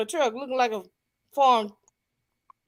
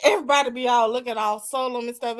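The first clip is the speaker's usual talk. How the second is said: ordinary talk, nothing marked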